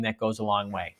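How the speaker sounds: normal talking voice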